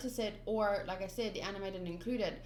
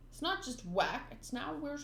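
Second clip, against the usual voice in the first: usual speaking voice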